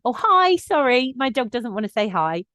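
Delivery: friendly but firm warning called across to an approaching owner. Shown as a demonstration. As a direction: sing it out politely, with a smile in the voice. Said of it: "when I call that out".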